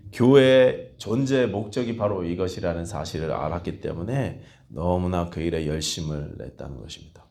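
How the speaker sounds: normal talking voice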